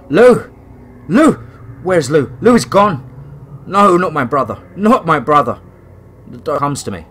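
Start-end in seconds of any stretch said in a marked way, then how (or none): 0.1-5.6 loud, high desperate calls; frantic calling. Acted. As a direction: panic rising as the wolf is gone. Frantic search for lost wolf.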